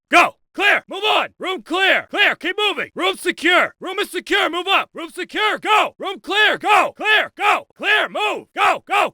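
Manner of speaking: high-pitched full shout, urgent commands; drill-sergeant shouting. Acted. Instructor driving a recruit room to room, shouting. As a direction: bellow rapid-fire orders at a recruit.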